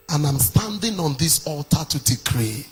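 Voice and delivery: rising, shouted decree over the congregation; full-force preaching. Real, not acted. Preacher decrees against curses.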